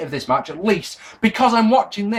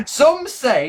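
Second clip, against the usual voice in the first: put-on higher-pitched accidental Yorkshire accent; 7 semitones higher than usual. Reviewer quoting commentator Jim Ross, accidental Yorkshire accent. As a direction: slip into a light Yorkshire accent. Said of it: "why I went to a Yorkshire accent".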